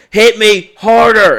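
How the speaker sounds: put-on high, loud, slow drunken yell; the drunk friend yelling a taunt after being hit. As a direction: taunt him to hit you again, yelling.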